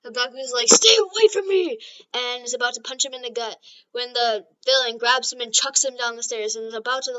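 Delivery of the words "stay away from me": high, loud warning yell; angry shout. Acted. Bakugo warding off villains, shouting. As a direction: yell it to push the attacker away. Acted villain fight in retold story.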